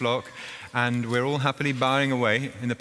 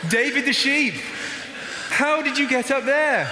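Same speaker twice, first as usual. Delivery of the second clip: high, surprised calling up; surprised shepherd; 12 semitones higher than usual. Acted. Shepherd surprised to find the sheep up high. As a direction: gasp up at the stray sheep.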